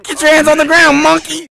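high, loud yelled order; shouting. Real, not acted. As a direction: bark the order at him. Yelling at another player.

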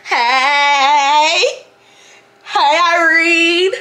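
long, stretched-out calls, rising at the end; hammed-up lovesick calling out; Stanley. Imitating a man hailing her across the gym, drawn-out calling. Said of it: "he's literally like".

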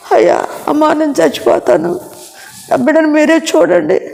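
high, tearful plea, as if dying; pleading from sickbed. Retold or imitated. Dying mother begging her brother to raise her child, tearful. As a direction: beg your family to take your child. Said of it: "అందరు కలిసి గళ్ళుమని ఏడ్చారు".

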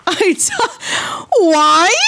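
high cracking wail; betrayed and heartbroken. Real, not acted. Betrayed by game.

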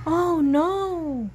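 storyteller's worried outburst; alarmed exclamation. A narrator's reading. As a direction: react to sudden danger. Alarm at the approaching stampede.

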